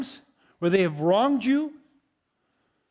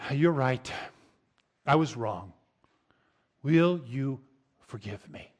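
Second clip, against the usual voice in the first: lower, softer, slow admission of fault; humble confession; 5 semitones lower, 3 dB quieter than usual. Shown as a demonstration. Pastor modelling humble words to someone you hurt, low and soft. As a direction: confess quietly, with humility. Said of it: "come to a person and say".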